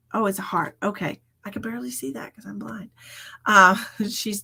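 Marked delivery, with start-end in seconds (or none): 1.5-2.9 quick whispered joke. Real, not acted. Joking about her own eyes, in a fast whisper. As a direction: rush it under your breath. Self-mocking aside.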